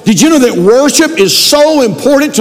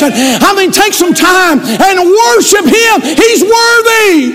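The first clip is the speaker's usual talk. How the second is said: fiery preaching at full force; 5 semitones higher than usual. Acted. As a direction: shout the praise out high.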